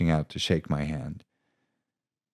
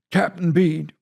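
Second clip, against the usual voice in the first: much higher, louder, cutting; commanding voice; 14 semitones higher, 7 dB louder than usual. Acted. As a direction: cut through the room with authority. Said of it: "voice cut through the command center".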